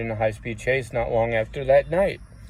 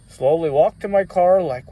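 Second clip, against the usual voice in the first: usual speaking voice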